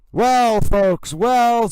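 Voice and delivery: loud, drawn-out newscaster opening; booming anchor opener. Acted. Anchor welcoming viewers back after recess, booming and loud. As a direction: boom it out like a TV host returning from break.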